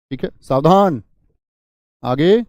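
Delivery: चेतावनी. Shown as a demonstration. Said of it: "चेतावनी बोधक".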